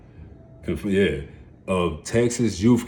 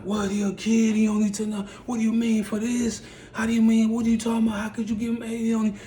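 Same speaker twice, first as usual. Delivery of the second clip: much higher, pleading and distraught; pleading brother; 14 semitones higher, faster than usual. Retold or imitated. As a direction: beg the judge in a high, broken voice. Brother crying pleads with judge.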